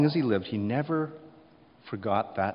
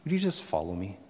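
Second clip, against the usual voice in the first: understated, simply put command, easing lower; plain, simple command; 3 semitones lower, 3 dB quieter than usual. Acted. Jesus repeating his command to Peter, plainly and simply. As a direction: give the instruction simply, settling down after the first name. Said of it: "plainly and simply".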